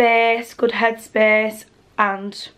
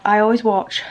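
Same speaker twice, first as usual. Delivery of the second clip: ordinary talk, nothing marked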